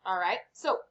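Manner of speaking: ordinary delivery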